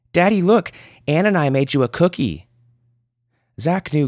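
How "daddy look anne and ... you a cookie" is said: put-on much higher, louder little girl; child excitedly calling her father. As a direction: show daddy the cookie, thrilled. Child shows daddy cookie.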